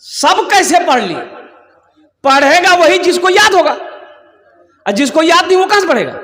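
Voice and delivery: high, emphatic rhetorical questioning; incredulous challenge. Real, not acted. Preacher disputing that everyone has recited, incredulous challenge.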